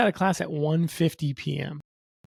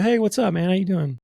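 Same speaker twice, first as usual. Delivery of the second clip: higher, louder cheery hello; friendly greeting; 5 semitones higher, 4 dB louder than usual. Retold or imitated. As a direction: call out to a buddy in the hallway. Greeting teammates on campus.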